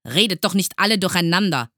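loud, high, fast reprimand; scolding. A narrator's reading. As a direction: scold a rowdy class. Teacher scolding noisy class. (laut schimpfend)

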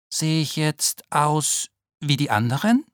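a worried small beetle asking for help; small ladybird Naoki. Acted. Naoki anxiously asking Frau Heuschrecke about his spots, timid and high.

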